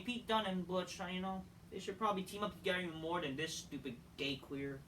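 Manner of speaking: ordinary talk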